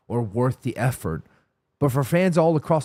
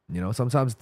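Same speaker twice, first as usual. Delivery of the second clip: normal talking voice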